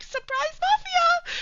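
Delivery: very high-pitched, drawn-out squeal; spring it on them in a squeaky voice; comic high singsong reveal; mock surprise announcement. Retold sudden reveal to her partner.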